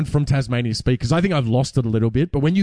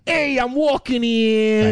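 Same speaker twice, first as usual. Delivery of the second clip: mock New York accent, much higher and slow; 10 semitones higher, much slower than usual. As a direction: play a pushy New Yorker, New York accent. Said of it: "a hell of a lot of New Yorkers".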